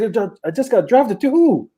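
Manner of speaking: stunned, asking who picked him; astonished. Retold or imitated. Player retelling his shock at the draft call.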